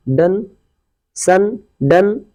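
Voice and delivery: "डन", "सन" with the o as a short uh; short uh sound. Shown as a demonstration. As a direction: slowly model "सन" and "डन", short vowel. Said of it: "यानी आ, आ निकल जाता है". ("सन", "डन" में ह्रस्व अ का उच्चारण)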